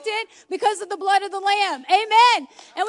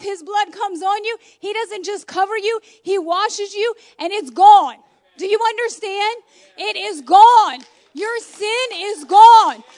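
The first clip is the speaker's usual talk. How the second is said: loud, rising preacher's cry, slowing on the key words; full-force preaching; 4 semitones higher, 9 dB louder than usual. Acted. Preacher to congregation on sin washed away, impassioned preaching. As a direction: preach it to the back row.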